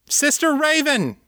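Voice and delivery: high, ringing angry shout; angry young woman calling out. A narrator's reading. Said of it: "angry and strangely familiar".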